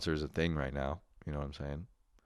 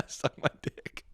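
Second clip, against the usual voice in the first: whispered jeer at Clayton, playful; mock whispered taunt; 5 dB quieter than usual. Podcaster whispers a taunt at absent friend Clayton, mock whisper. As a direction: breathe the insult out as a tease.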